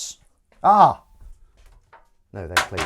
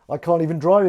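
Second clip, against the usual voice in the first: higher, projected delivery; loud admission; 5 semitones higher, 8 dB louder than usual. Acted. Host repeating his confession for effect, loud admission. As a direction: throw it out loud and high.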